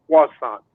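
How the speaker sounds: croissant said the French way, "kwah-SAHN"; affected French pronunciation. Retold or imitated. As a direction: imitate her Frenchified croissant, dropping the R. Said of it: "She doesn't order a croissant. She orders a".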